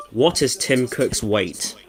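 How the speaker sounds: talking to voice assistant. Real, not acted. Repeats the query slowly.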